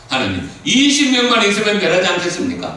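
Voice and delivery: high, loud, earnest plea; pleading Abraham. Acted. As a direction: cry out to God, begging.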